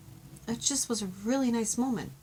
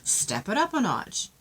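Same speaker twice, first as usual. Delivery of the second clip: emphatic; 2 semitones higher, 4 dB louder than usual. Shown as a demonstration. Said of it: "That came across".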